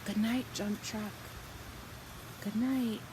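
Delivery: usual speaking voice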